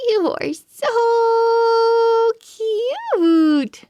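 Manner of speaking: put-on high, slow, gushing girl voice; adoring girl voice. Bella meets the pug at the fair, gushing. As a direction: coo over the puppy.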